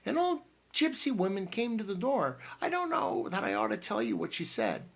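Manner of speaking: an excited aunt bursting into the room; Aunt Matilda. A narrator's reading. Aunt Matilda bursting in on the boys, high and bustling.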